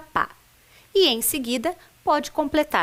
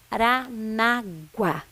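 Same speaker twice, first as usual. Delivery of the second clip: slow "Paranaguá", each syllable spaced out; much slower than usual. Shown as a demonstration. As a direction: reveal Paranaguá piece by piece. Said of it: "vamos ver quem adivinha". (palavra revelada sílaba por sílaba, devagar)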